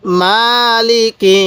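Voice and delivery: মালিকি read very slowly, with মা held long and লি and কি kept short; slow drawn-out recitation. Shown as a demonstration. Teacher reciting মালিকি for students, slowly drawn out. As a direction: stretch only the মা in মালিকি. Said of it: "কীভাবে পড়ব".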